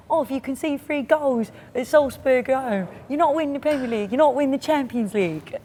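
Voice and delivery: mock Cockney accent.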